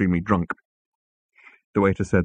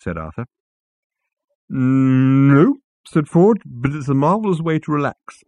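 put-on higher, eager drunk Ford; Ford's tipsy enthusiasm; 6 semitones higher, 3 dB louder than usual. Ford explains his clever bit, eager and tipsy. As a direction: rattle on like a pleased drunk.

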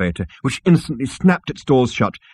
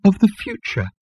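high-pitched miserable falling answer; high, woeful elevator; 11 semitones higher than usual. A narrator's reading. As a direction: confess it wretchedly in a high voice. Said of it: "said the elevator miserably".

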